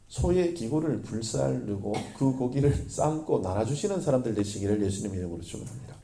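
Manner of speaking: ordinary talk, nothing marked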